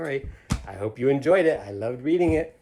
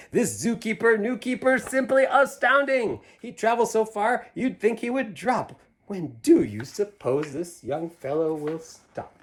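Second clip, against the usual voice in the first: high, louder marveling crowd; amazed townsfolk; 11 semitones higher, 9 dB louder, slower than usual. Acted. People praising the zookeeper, high and amazed. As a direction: sing out the crowd's wonder. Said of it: "people will say".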